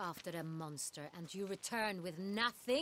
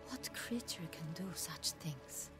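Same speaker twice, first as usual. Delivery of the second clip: hushed disbelief; 5 dB quieter than usual. Acted. Duchess appalled by vampire's powers.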